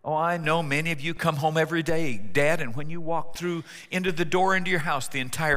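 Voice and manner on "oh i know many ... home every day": normal talking voice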